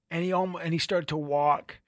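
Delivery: ordinary delivery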